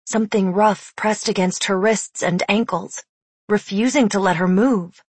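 plain reading voice

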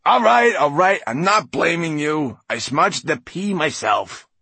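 high, loud backing down; exasperated placating. A narrator's reading. Writer placates offended glass man, exasperated. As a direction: give in loudly to calm a tantrum.

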